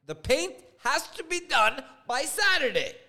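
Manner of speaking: loud, high, desperate insistence; panicked urgency. Retold or imitated. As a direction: shout the deadline in a panic. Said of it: "hears the panic in my voice".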